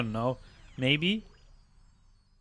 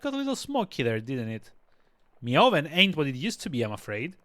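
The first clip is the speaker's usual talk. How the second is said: a hint of a rustic British accent; old cook with a light rustic British accent; put-on accent. Cook apologising for the smoky oven, rustic British accent. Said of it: "that's an accent".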